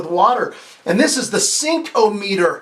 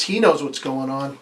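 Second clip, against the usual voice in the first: usual speaking voice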